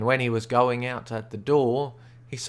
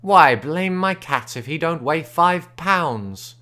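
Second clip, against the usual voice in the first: surprised Smiley; 4 semitones higher, 8 dB louder, slower than usual. A narrator's reading. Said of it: "and says".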